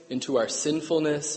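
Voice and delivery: normal talking voice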